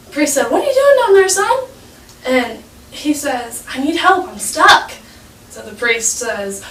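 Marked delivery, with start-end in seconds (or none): 0.5-1.6 very high put-on questioning voice; hammed-up high call down to someone below; priest calling down. Priest calls down to a boy in a hole, puzzled. 3.7-4.9 higher-pitched acted plea; mock helpless plea, pitched up; pleading for help. Boy stuck in a hole answers the priest, pleading.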